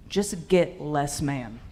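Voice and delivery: usual speaking voice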